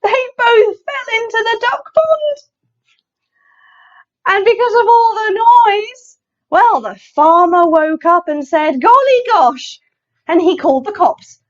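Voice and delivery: high, loud, dramatic picture-book narration; animated, exclaiming storyteller; excited story reading. A narrator's reading. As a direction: make the mishap a big event for children. Reads picture book to children.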